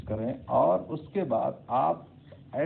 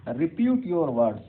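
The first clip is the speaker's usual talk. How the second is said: blunt order; 4 semitones higher, 6 dB louder, much slower than usual. Shown as a demonstration. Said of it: "I beg your pardon".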